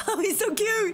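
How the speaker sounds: high-pitched gushing squeal. Real, not acted. Player fawning over the game's sweet couple, gushing. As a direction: coo over them, voice leaping high.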